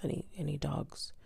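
normal talking voice

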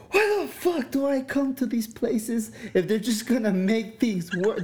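retold fuming rant, high and drawn out, laughing; fuming, laughed through. Client venting to friend after therapy, retold fuming with laughs. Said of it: "I remember I came out fuming".

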